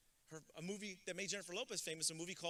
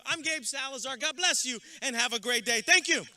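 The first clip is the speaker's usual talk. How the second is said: much louder and higher, fired-up address; loud, passionate send-off to the graduates; rousing speech finish; 9 semitones higher, 12 dB louder than usual. Acted. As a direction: lift the whole room at the finish. Rousing close of commencement speech.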